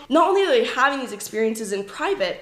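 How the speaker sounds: ordinary talk, nothing marked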